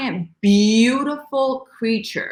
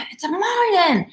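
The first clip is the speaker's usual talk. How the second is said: excited call; 12 semitones higher than usual. Retold or imitated. Someone on the boat spotting the fish, high excited call. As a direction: cry out the discovery. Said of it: "and somebody went".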